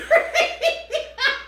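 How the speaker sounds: breaks into a squealing laugh; can't keep a straight face; high burst of laughter. Real, not acted. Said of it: "I couldn't even say that with a straight face".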